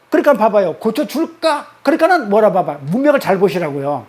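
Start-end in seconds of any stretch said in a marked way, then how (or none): 0.7-1.7 고쳐줄까 pitched higher and rising; asking, not promising, 고쳐줄까 up; rising question. Shown as a demonstration. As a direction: make 고쳐줄까 a bright high question. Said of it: "왜 물어봐요?".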